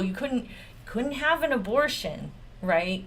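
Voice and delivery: ordinary talk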